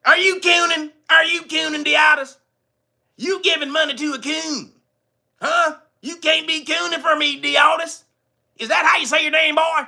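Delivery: high-pitched, hammed-up accusing; mock interrogation. Host accusing a donor he just thanked, mock-indignant questioning. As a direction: grill him with playful indignation, high and insistent.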